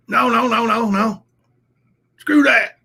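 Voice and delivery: loud, high furious no no no; angry refusal. Retold or imitated. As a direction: shout it down, fed up. Acting his own angry refusal.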